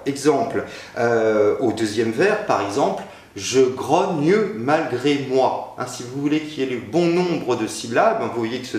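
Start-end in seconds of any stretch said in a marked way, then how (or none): 3.4-5.7 "grogne" said as "grogne-u", the final E sounded as its own syllable, slow; mute e pronounced. Shown as a demonstration. Teacher showing students the alexandrine count, "grogne" with a sounded E. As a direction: sound the E of "grogne" clearly, gro-gne. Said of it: "bien prononcer le E de grogne".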